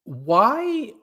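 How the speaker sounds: long, rising, high-pitched plea; mock accusation. Co-host teasing Alex over a movie, mock-accusing. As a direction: stretch it out in mock dismay.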